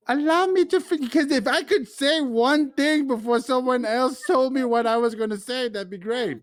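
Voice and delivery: politely fed up with interruptions; exasperated. Real, not acted.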